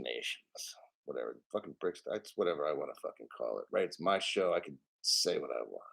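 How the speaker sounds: very soft, low grumble under the breath; muttered aside. Real, not acted. Host brushing off the wrong name for brics, muttered aside. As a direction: mutter it low to yourself, off to the side.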